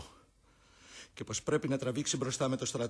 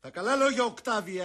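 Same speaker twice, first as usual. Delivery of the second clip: much higher, much louder rebuke; loud retort; 8 semitones higher, 8 dB louder than usual. Acted. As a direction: throw it back at Octavius. Brutus retorts to Octavius. (ο Βρούτος απαντά δυνατά στον Οκτάβιο)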